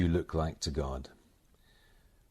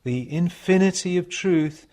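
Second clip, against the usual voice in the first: measured devotional recitation, higher and louder than chat; meditation reading; 7 semitones higher, 9 dB louder, slower than usual. Acted. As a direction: read it as a solemn meditation, pausing between phrases. Reads received passage as a meditation.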